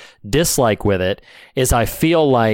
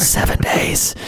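whispered ghostly voice; haunting voice; slower than usual. Retold or imitated. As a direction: breathe it out like a ghost on the phone. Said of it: "a cryptic, uh, ghostly voice says".

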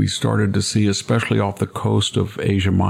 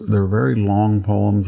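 usual speaking voice

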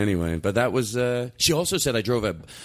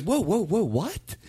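mock startled mom; 8 semitones higher, slower than usual. Mother taken aback by son's school-trip question, mock startled. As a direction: imitate a mother caught off guard.

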